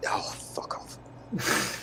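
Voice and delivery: whispered fuck off. Real, not acted. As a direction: breathe it out under your breath. Muttered retort to insult.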